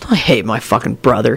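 low-pitched, casual confession; conversational. A narrator's reading. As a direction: state it low and matter-of-fact. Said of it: "he said to Victor conversationally".

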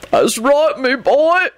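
high, tearful howl; howling grief. A narrator's reading. Bald giant agrees with Benji, howling with grief. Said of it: "he howled".

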